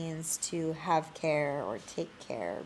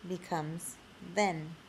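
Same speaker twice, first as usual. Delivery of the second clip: ordinary talk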